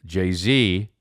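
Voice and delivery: usual speaking voice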